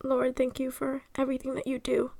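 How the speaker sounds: low, soft, unhurried prayer; spoken prayer. Real, not acted. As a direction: pray quietly, with halting pauses. Leads a closing prayer.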